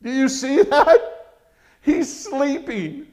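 high, slow, with a laugh, SLEEPING rising in disbelief; amazed wonder. Real, not acted. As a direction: marvel at it out loud. Marvelling at sleeping Peter.

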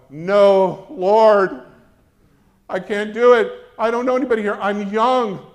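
loud, high pleading refusal; desperate cry to God, like Moses; panicked protest. Retold or imitated. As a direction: beg out of it in a panic. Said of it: "And I'm going".

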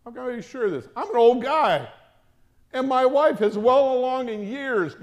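put-on doubting old man. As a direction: sound unconvinced and put out. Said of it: "asked the angel".

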